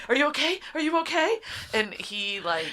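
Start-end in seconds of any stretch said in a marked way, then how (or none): 0.1-1.4 high, urgent, worried questions; hammed-up startled concern; startled worry. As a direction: jolt awake and ask in alarm. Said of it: "jerked awake and is like".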